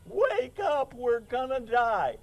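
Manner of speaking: high panicked cries, wake up; panicked yelling. Retold or imitated. Preacher acting the disciples waking Jesus in the storm, panicked high yelling. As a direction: yell like the boat is sinking. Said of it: "they're all like".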